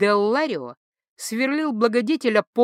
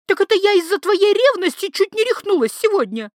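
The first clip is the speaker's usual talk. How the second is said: much higher, louder furious yell; enraged wife; 11 semitones higher, 6 dB louder than usual. A narrator's reading. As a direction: scream it at her husband. Wife keeps screaming. (взбешённый крик)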